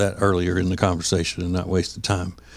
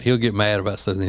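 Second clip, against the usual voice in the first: ordinary talk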